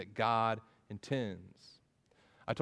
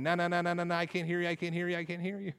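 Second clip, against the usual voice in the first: high, rapid sing-song chant; mock childish "la-la-la" monotone; child refusing to listen; 4 semitones higher, faster than usual. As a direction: imitate a kid blocking out sound. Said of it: "of a child sitting on the floor with his fingers in his ears".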